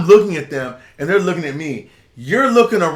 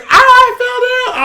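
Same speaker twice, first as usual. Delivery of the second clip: much higher, louder, slow whiny mock complaint; imitating the lady; 5 dB louder, slower than usual. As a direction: play the fallen lady moaning in pain, hammed-up. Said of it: "The lady's like".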